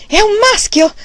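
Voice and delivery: high, loud joyful cry; new father bursting out in triumph; excited shout. A narrator's reading. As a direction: shout it out, stamping with joy. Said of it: "gridò scalpitante".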